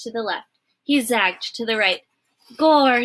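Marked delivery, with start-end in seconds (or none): 2.6-3.0 loud, higher cry; shouting Midge. A narrator's reading. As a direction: yell the oath. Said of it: "shouted Midge".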